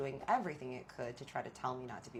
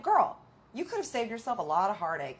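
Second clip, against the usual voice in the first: exasperated scolding; 5 semitones higher, 7 dB louder than usual. Pretend. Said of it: "I wanna shake her".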